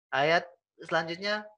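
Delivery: ordinary delivery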